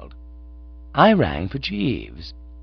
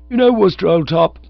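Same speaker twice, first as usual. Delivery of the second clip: put-on high, loud young-chap voice; cheerful; 10 semitones higher, 8 dB louder than usual. A narrator's reading. Cyril chatting to his friend, high and cheerful. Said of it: "quite fairly cheerful".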